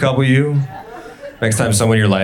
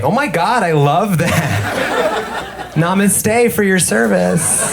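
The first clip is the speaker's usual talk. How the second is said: gushing fan; 9 semitones higher, much slower than usual. Retold or imitated. Gushing audience member quoted.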